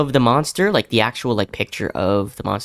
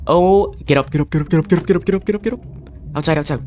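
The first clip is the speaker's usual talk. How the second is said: high rushed repeated get up; panicked urging; 4 semitones higher, faster than usual. Real, not acted. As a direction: scramble away in panic. Fleeing up the stairs.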